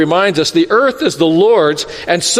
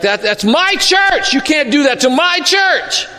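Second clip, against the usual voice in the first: indignant church member; 7 semitones higher than usual. Retold or imitated. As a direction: play the affronted member yelling. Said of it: "they're like".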